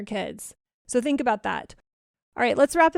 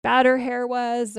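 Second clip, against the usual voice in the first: normal talking voice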